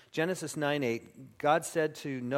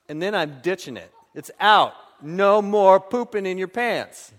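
mock fed-up parent; 5 semitones higher, 11 dB louder than usual. Parent ditching baby, mock.

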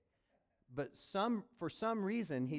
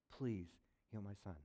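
much softer, worn-out begging; quiet pleading; 5 semitones lower, 6 dB quieter than usual. Retold or imitated. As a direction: plead under the breath, spent.

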